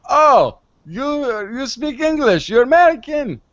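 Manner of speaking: a hammed-up Bavarian accent for a Danish local; mock Bavarian accent. Danish barman switching to English with the tourist, in a mock Bavarian accent. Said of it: "I'm, like, doing a Bavarian accent".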